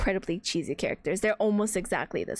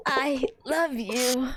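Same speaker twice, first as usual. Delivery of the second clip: mock romantic confession; much slower than usual. Mocking game confession.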